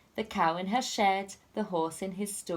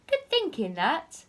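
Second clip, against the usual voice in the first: pleased thief; 8 semitones higher than usual. Acted. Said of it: "said Hefty Hugh".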